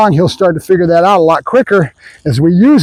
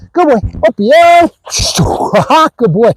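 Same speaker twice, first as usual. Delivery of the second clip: excited dog praise; 6 semitones higher than usual. Real, not acted. Trainer cheering his jumping dog: high, eager praise.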